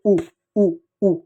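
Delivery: "ऊ" said short, as a quick "u"; brief, separate "ऊ" sounds for teaching; short vowel. Shown as a demonstration. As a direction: cut each "ऊ" off quickly, like उ. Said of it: "छोटा ऊ".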